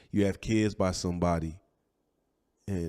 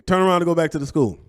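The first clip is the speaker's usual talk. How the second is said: loud, high imitated command; mock police loudspeaker order; 10 semitones higher, 10 dB louder, faster than usual. Police ordering the fleeing car back, loud mock command. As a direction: call it out like police on a speaker. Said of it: "They on the speaker".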